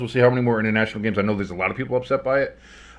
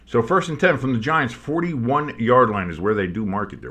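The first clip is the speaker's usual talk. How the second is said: usual speaking voice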